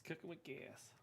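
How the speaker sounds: soft muttered repeat; quiet aside. Acted. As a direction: say it softly to yourself.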